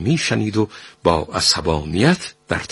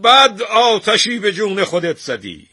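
raised, high-pitched threatening call; loud voice; 13 semitones higher, 6 dB louder than usual. A narrator's reading. Shah threatens the painter who left, loudly. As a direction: hurl a loud threat at his back. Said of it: "رضاخان با صدای بلند گفت".

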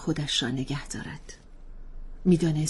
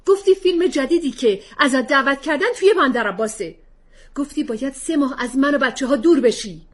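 much higher, louder indignant father; angry retort; 11 semitones higher, 10 dB louder than usual. Acted. Husband answering wife's accusation, angry. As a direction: shoot back, wounded and angry.

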